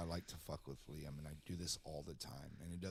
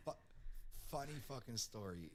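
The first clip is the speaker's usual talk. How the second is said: normal talking voice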